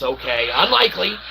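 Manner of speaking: ordinary talk, nothing marked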